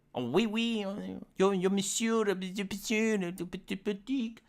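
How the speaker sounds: soft, lilting mock French. Streamer mocks French speech in a soft, dainty voice. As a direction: prance through fake French babble. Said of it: "the people from France".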